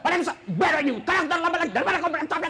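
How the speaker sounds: fast, rough scolding; mock rude lecturer; harsh teacher. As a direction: snap at the late student. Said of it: "coba kalau dia malu".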